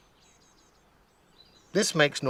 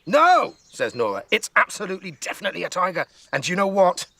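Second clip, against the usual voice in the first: excited insistence; 8 semitones higher, 3 dB louder than usual. A narrator's reading. Nora insists to Grandma.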